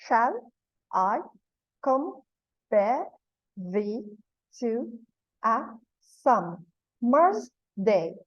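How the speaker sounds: Shall, I, compare, thee, to, a, summer's, day each set apart by pauses; very slow, separated syllables on "Shall I compare thee to a summer's day"; syllable-by-syllable scansion. Shown as a demonstration. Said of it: "one stressed and one unstressed syllable".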